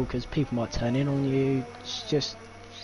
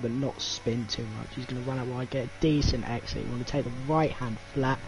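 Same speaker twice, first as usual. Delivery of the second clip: live commentary; 4 semitones higher than usual. Acted. Live lap play-by-play.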